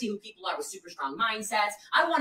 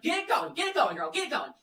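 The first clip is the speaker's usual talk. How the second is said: cheering on; 6 semitones higher than usual. Retold or imitated. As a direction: pump her up. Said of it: "But I'm like".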